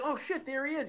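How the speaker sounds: a touch of starstruck excitement; excited whisper-ish. Retold or imitated. Excited spotting of celebrity.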